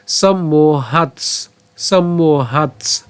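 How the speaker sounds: সম্মোহাৎস slow, ending in a "ts" cluster; alternative pronunciation demo. Shown as a demonstration. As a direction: stretch সম্মোহাৎস and close on "hats". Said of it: "খণ্ডতের সাথে দন্ত উচ্চারণ করবো".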